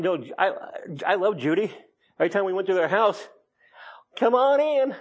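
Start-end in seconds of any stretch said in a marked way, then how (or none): 4.2-4.9 high, drawn-out Southern welcome. Retold or imitated. Friend Judy welcoming guests, in a Southern accent. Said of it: "That Southern accent just".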